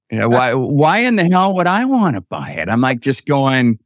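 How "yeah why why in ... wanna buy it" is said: higher-pitched balking question; retold incredulous protest. Younger brother balking at buying dad's boat, incredulous. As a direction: push back in mock disbelief.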